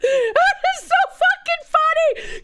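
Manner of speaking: high, slow words squeezed through laughter; can barely talk for laughing; laughing hard. Real, not acted. Laughing at own impression.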